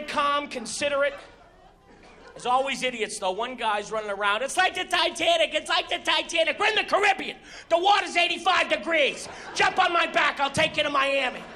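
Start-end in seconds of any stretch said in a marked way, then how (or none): 4.4-11.4 high-pitched frantic yelling; higher, excitable comic holler; mock panic. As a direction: act out a panicking passenger and his retort. Idiot running around in panic.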